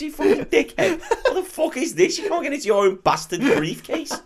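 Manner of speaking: high-pitched, laughing disbelief; mock incredulous rant. Mocking a man locked out of his briefcase, incredulous. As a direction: berate the idiot while cracking up.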